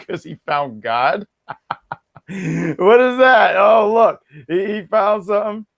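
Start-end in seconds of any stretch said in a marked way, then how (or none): none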